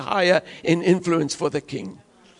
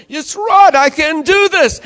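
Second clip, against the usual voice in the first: much higher and louder, triumphant; confident self-talk; 9 semitones higher, 7 dB louder, slower than usual. Retold or imitated. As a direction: cry it out like you just believed it.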